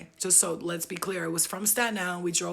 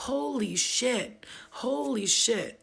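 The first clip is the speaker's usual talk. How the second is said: drawn-out stunned holy shit, very slow; slow awed holy shit; much slower than usual. Pretend. Looking back on life, slow stunned awe. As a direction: stretch it out as if amazed at your own life.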